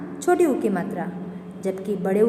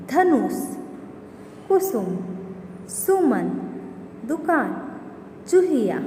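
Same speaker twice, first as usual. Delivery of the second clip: clear demo words; 2 semitones higher than usual. Shown as a demonstration. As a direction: read the examples out like a teacher to a class. Teacher reads example word list.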